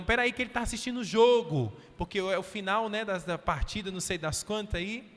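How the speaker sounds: excuse-maker voice. Retold or imitated.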